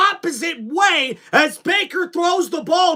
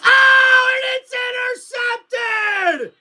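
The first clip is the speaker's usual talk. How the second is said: shocked shout; 8 semitones higher, faster than usual. Real, not acted. Interception call, live reaction.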